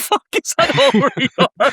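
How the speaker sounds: high, laughing protest. Real, not acted. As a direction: squeak it out mid-laugh. Laughing through the question.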